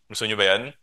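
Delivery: ordinary talk, nothing marked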